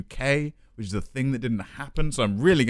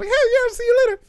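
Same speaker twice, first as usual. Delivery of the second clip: put-on very high American accent, near falsetto; Mickey Mouse American; 5 dB louder, faster than usual. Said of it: "it ended up like".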